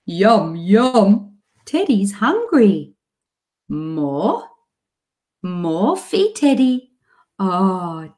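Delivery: put-on slow, sing-song talk to a toddler, pitch swooping high; animated sing-song voice. Shown as a demonstration. Mum feeding teddy with her child, animated sing-song. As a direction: play with a little child in short, drawn-out, lilting phrases. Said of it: "an animated voice. So it'll sound a bit like this".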